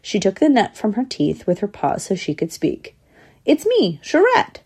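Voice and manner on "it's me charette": high, sweet little voice; sugary and high; sweetly. A narrator's reading. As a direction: introduce yourself sweetly, pitched right up. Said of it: "she said sweetly".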